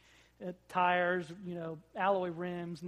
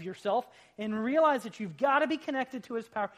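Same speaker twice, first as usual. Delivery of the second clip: full-force preaching; 5 semitones higher, 8 dB louder than usual. Acted. Pastor urging his congregation to depend on Christ, fervent preaching. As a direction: preach it, pound every "you can't".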